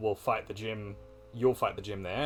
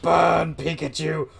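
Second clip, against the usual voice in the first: yelling; 5 semitones higher, 9 dB louder, slower than usual. Real, not acted. Urging the battle on.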